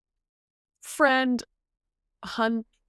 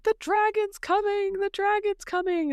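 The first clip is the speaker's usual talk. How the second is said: high mock alarm cry; panicked townsfolk; 12 semitones higher than usual. Villagers warning of the dragon, high frantic mock cry.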